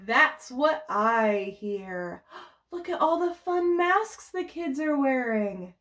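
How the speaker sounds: ordinary talk, nothing marked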